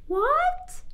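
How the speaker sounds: very high rising shocked question; stunned, squeaking what. Acted. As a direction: gasp it out in disbelief. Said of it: "her mouth threatening to drop open".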